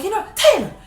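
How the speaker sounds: high, loud scolding; hammed-up nagging grandma; high shrill-free mock scolding; mock scolding grandmother. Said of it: "she was like".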